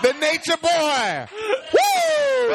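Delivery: high, loud wrestler whoop; Ric Flair woo. Retold or imitated. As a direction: belt it out like a pro wrestler.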